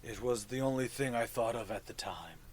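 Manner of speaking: low, soft fungal creature voice; mushroom voice. Acted. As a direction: speak low and hushed like a mushroom.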